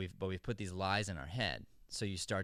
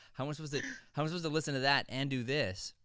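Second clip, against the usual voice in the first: higher, laughing disbelief, then a louder protest; baffled actor; 6 semitones higher, 3 dB louder than usual. Retold or imitated. Actor protesting the director's note mid-take, baffled and laughing. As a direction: laugh in confusion, then push back. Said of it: "And you're like".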